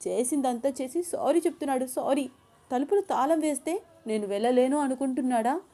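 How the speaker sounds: grumbling angrily under her breath as the heroine; irritated heroine. A narrator's reading. Ananya's angry inner monologue.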